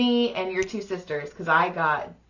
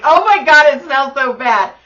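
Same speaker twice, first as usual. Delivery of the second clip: louder, higher cry of disgust; disgusted yell; 5 semitones higher, 12 dB louder than usual. Retold or imitated. Reacting to brother's fart, a loud disgusted cry. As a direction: recoil loudly at the stink. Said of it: "and I was like".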